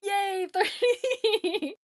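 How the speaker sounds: slow, high, laughing yay; laughing cheer. Real, not acted. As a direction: stretch out a giggly cheer. Cheering the clock time.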